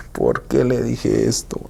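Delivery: regretful whisper. Retold or imitated. Regretting words said to himself.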